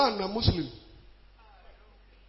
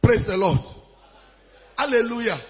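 usual speaking voice

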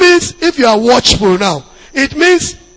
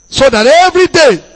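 forceful preaching; 3 semitones higher, 7 dB louder than usual. Acted. Pastor urging daily prayer, louder. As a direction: hit it with force.

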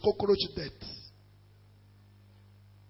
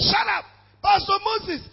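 demons shouting; 9 semitones higher than usual. Retold or imitated. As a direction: scream it as the spirits.

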